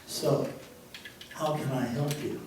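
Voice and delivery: a soft, calm question from behind the desk; gentle chaplain. Retold or imitated. The chaplain quietly asks the tough young man, softly. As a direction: ease him in quietly.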